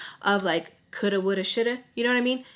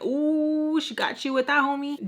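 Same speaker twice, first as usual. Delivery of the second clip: teasing reaction; 6 semitones higher than usual. Retold or imitated. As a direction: rib a friend after a burn. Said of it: "Nacho starts laughing".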